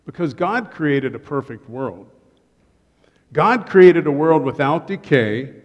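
ordinary talk, nothing marked

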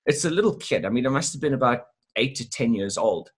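ordinary delivery

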